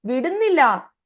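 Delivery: ordinary talk, nothing marked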